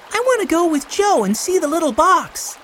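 put-on high, eager child; high-pitched little-boy wish; little boy Benny. A narrator's reading. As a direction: plead like a keen small boy.

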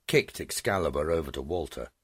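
ordinary delivery, nothing marked

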